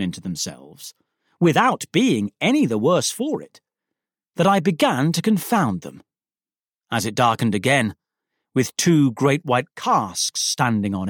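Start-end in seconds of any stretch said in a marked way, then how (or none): none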